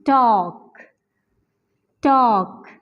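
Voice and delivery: टॉक with a silent L and stress on the whole word; टॉक said as one stressed syllable with no L; correct pronunciation demo. Shown as a demonstration. Teacher models talk for students. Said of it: "हम कहते हैं टॉक".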